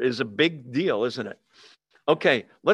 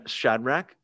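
ordinary delivery

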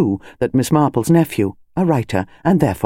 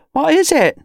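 much higher woman's voice; curious wife; 13 semitones higher than usual. Acted. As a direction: call it from the kitchen.

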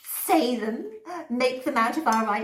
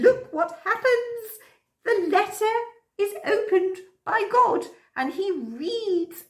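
much higher, louder and slow in wonder; excited wonder; 11 semitones higher, 4 dB louder than usual. Acted. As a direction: marvel at the letter opening. Showing the letter open.

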